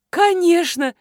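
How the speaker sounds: loud, high-pitched shout; joyful cry. A narrator's reading. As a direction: cry out an eager yes. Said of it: "закричала Марина". (радостно закричать)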